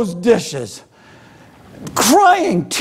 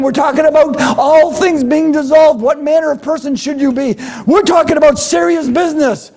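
preaching at full force; 7 semitones higher than usual. Acted. Pastor urging the church about eternal life, fervent. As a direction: drive it home to the congregation.

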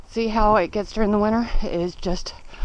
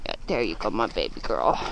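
low soft coo to horse; 11 semitones lower, 5 dB quieter than usual. Real, not acted. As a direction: murmur to her gently. Soothing horse.

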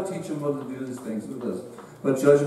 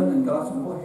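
usual speaking voice

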